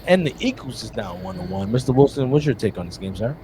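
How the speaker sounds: sports anchor read. Acted. Mock host reads result, asks guest.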